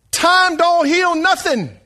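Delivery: put-on loud, high, slow Franklin accent. Preacher playfully rebuts a saying in a Franklin accent. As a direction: draw it out big in a Franklin accent. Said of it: "That's my best Franklin accent".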